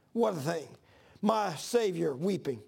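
ordinary delivery

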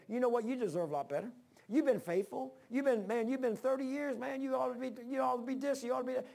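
high, coaxing devil voice; flattering devil. Retold or imitated. Preacher voicing the devil flattering him about thirty years, high coaxing. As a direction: puff him up like a sly tempter. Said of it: "the devil starts saying".

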